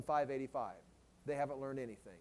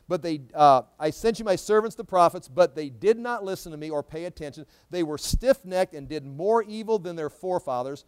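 loud, emphatic Bible proclamation; forceful scripture reading; 7 dB louder than usual. Acted. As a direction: preach the verse out with force. Reads scripture aloud to congregation.

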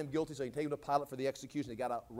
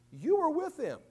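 high-pitched acted accusation; accusing recognition; 11 semitones higher than usual. Servant girl recognizing Peter, high accusing voice. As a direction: point him out in a raised pitch.

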